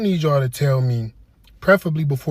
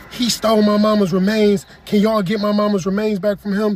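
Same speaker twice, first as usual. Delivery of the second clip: mock angry complaint; 6 semitones higher than usual. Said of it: "Talking about".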